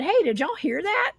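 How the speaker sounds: retold startled call, voice jumping high; startled question. Hiker asking companions about a strange voice, startled. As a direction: ask it high, as if checking with companions.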